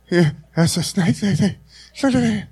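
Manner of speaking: hammed-up, run-together repetition; mock stammering. Preacher mimicking a reply about Godzilla snake, mock stammering. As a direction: rattle off the repeated word in a put-on voice.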